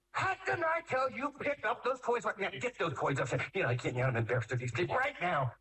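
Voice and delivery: high, flustered scolding that sinks back down; a parent scolding kids in front of friends; embarrassed scolding parent. Retold or imitated.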